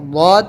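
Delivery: "واد" said thick and full-mouthed; thick, heavy letter. Shown as a demonstration. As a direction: give "واد" a heavy, rounded sound. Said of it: "اس کو موٹا ہی بولنا ہے".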